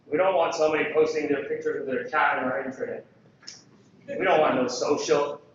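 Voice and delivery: mocking a skeptical boss; mock decision maker. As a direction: play the stubborn exec brushing it off. Quoting reluctant decision makers.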